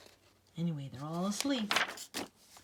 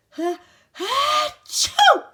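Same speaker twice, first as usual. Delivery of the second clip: high, very loud ha-choo; huge hammed-up sneeze; big sneeze; 14 dB louder, much slower than usual. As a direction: let the sneeze build, then burst. Flea sneezes in story.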